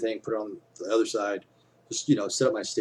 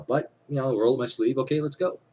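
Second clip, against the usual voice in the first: acting confident; 6 semitones higher, 6 dB louder than usual. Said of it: "I was trying to act confident".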